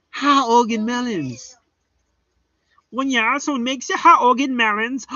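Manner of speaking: Ha Ogden melons in a high put-on accent; high mock accent on Ha Ogden melons. Shown as a demonstration. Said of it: "I had to use the accent".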